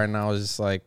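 ordinary talk

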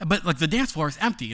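ordinary delivery

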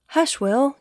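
high-pitched, mild reprimand; scolding. Acted. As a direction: shush him like a bossy child. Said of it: "Katy scolded".

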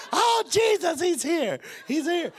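very high, loud frantic cry; hammed-up panic. Boy mistakes dad's arrival for Jesus, hammed-up panic. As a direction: shriek it in mock terror.

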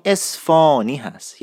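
"اصفهانی" as es-foo-ni with open long aw, no h; correct local pronunciation. Shown as a demonstration. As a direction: drop the h and stretch the middle vowel in "اصفهانی": esfaaani. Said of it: "اسمش در واقع در تلفظ صحیح".

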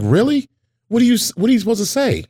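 high, quick surprised questions; mock-innocent astonishment; mother's retold surprise. Mother's surprise at being corrected.

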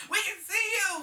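loud high mock yell. Joining the friend's retold yell at a stranger, loud mock yell. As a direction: shout it over the laughter. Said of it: "yelling at stripping man".

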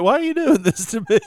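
high, laughing mock whine; lightly imitated plea through laughter; mock embarrassed protest. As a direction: protest like a teased, bashful friend. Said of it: "You got a little bashful earlier".

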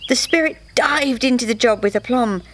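ordinary delivery

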